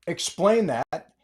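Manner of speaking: slow, each word of "Explain that" pressed out; slow emphatic demand. Real, not acted. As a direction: drag out the demand. Repeated demand.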